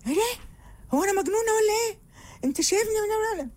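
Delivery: high, fast offended protest; mock angry retort. Angry wife snapping back at her husband, high and fast. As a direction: fire back high and quick, insulted. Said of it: "هدي نفسك بقى".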